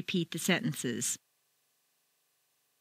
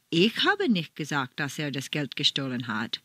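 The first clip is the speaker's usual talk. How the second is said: "Ich habe" raised, everything after low and even; stress on ICH HABE; 6 semitones lower than usual. Shown as a demonstration. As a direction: lean on "Ich habe", then let the rest fall away low. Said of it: "you would change the stress to".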